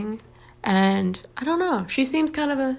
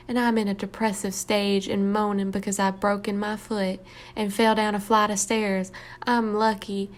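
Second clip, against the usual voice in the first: put-on woeful Southern accent. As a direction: read it as a self-pitying Southerner. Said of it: "It's like a woe is me voice".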